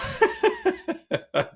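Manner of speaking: high, loud giggling laugh; helpless amused laughter. Real, not acted. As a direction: crack up for real. Cracking up over the tangent.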